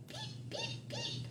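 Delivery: very high, very soft tiny peep; chick peep. Acted. Reading the farm book, peeping like tiny chicks.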